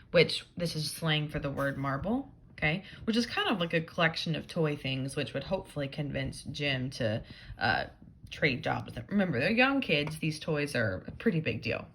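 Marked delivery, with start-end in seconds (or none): none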